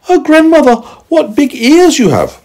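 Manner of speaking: put-on very high, loud girl voice; little girl amazed. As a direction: exclaim high up like a small girl.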